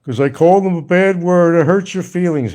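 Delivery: high, loud mocking singsong of his complaint; mocking whine. Mocking a jailed critic's hurt feelings, a high loud whine.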